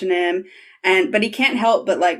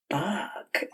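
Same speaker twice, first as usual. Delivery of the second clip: impression of turned-on character; 11 dB quieter than usual. Host imitating Ilya watching him, hushed awed curse. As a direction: let it out softly, drawn under the breath. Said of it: "really nice impression of it".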